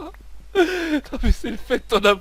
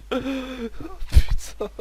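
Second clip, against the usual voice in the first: wheezing through helpless laughter; gasping out words between laughs; crying with laughter; 7 semitones higher, 5 dB quieter than usual. Real, not acted. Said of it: "j'en pleure de rire". (en pleurant de rire, à bout de souffle)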